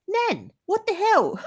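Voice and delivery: very high, slow mortified protest; appalled. Retold or imitated. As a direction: stretch it out in disbelief at grandma. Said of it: "I was like".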